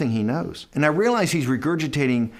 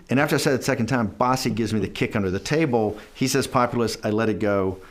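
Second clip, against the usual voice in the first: usual speaking voice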